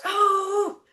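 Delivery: high, let-down little "Oh."; a thwarted foal giving up; deflated horse "oh". Retold or imitated. The foal caught stepping over the line, crestfallen high "oh".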